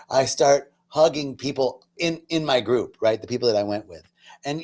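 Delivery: ordinary delivery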